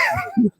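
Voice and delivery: breaking into high laughter. Real, not acted. Can't keep a straight face.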